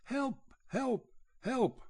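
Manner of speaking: high panicked cries for help; terrified cry. Acted. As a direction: yell for help in sheer terror. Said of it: "terrified out of his wits".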